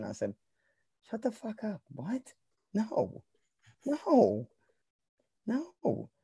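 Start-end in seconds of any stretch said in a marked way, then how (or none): 1.1-6.1 squeaky, much higher mock protest; mock outraged teen. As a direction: play your younger self snapping at the family idea. Retold refusal of parents' vacation idea.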